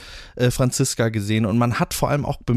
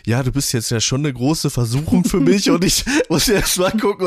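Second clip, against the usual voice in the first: mocking Max; 6 semitones higher than usual. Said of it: "sagt er".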